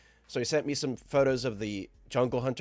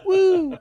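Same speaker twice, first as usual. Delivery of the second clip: high, loud "woo" cheer; short, loud falsetto whoop; demonstrated woo; 12 dB louder than usual. Shown as a demonstration. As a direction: let out a big high-pitched woo. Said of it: "I'll just have to go".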